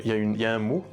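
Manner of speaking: usual speaking voice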